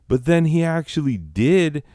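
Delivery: normal talking voice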